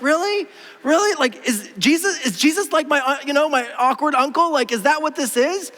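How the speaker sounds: high-pitched, disbelieving questioning; mock incredulity. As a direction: question it as if you can't believe it. Acts incredulous reaction to Jesus' words.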